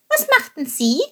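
high, light bird voice; small eager bird asking; little bird voice. Acted. Little bird Chilp asks.

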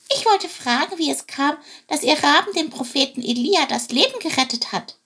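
high voice of a nervous little bird; nervous sparrow. Acted. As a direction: get it out timidly, like a small sparrow. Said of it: "brachte Chilp heraus".

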